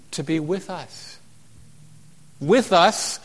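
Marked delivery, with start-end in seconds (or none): 2.4-3.2 "With us" cried out higher and louder; proclaimed. Acted. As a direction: ring out "With us" from the pulpit. Preacher exclaiming key phrase.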